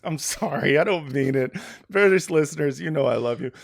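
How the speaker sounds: ordinary talk, nothing marked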